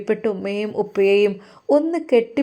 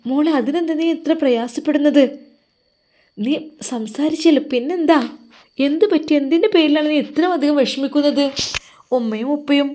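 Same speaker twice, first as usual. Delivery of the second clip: higher, louder, pressing questions; insistent questioning; 6 semitones higher, 6 dB louder than usual. Acted. Bivi presses crying Farzana for the reason, higher and louder. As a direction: push her to say what is wrong, voice rising.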